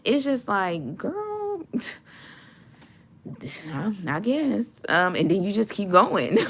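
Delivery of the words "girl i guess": put-on unbothered girl. Mocking shrug of the haters.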